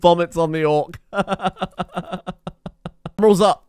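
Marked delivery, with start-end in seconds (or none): 1.0-3.1 helpless laughter. Real, not acted. Laughing at vomiting pawn.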